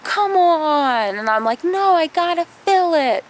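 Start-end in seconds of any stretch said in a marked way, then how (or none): 0.0-1.1 put-on high whining kid begging, rising loudest on let me in; pleading like a little boy desperate to swim; whiny little brother. Little brother begging [name] to let him in the pool, high and whiny. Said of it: "and he's like". 1.6-3.2 high insistent big sister; a sister snapping back at her brother; retold refusal. [name] refusing her brother while she fills the pool, high and insistent.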